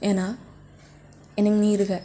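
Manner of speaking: ordinary talk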